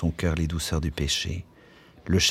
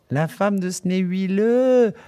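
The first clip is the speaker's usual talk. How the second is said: high, loud, slow shriek of glee; 13 semitones higher, 6 dB louder, slower than usual. Acted. Barmaid Miss Douce shrieking with laughter about Bloom's wife, loud. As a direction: shriek it out in mocking delight. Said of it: "hurla-t-elle".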